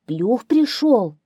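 high, loud, slow fox voice; fox answering. A narrator's reading. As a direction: call it out as the fox. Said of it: "отвечает лиса". (голос лисы, высоко и протяжно)

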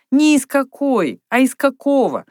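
"какой" and "какого" said high and loud as a contrast; high, insistent correction stressing "какого"; correcting. Acted. Наташа поправляет подругу.

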